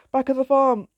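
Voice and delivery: loud, high urgent call; crying out. A narrator's reading. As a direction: shout in panic while fleeing. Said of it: "cried Jack".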